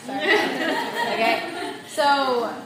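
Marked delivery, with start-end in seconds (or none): 0.2-1.1 laugh. Real, not acted. Laughs at own caveman voice.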